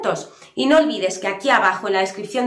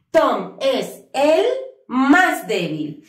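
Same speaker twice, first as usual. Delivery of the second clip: slow model phrase; much slower than usual. Shown as a demonstration. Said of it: "teacher models the phrase slowly".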